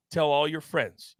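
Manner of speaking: ordinary talk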